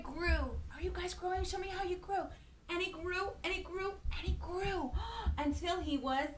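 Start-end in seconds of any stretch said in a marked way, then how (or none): none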